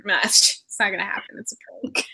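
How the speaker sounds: whispered, half-joking disbelief; hushed denial. Pretend. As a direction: whisper it like a secret you refuse to believe. Mock denial, imitating reporter.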